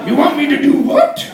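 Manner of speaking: mock baffled question; confused girlfriend.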